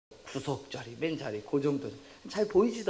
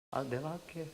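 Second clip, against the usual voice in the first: soft, resigned offer; meek giving in; 8 dB quieter than usual. Acted. Class president takes over bully's cleaning, meek and soft. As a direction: drop the volume and give in.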